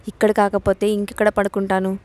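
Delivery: ordinary delivery, nothing marked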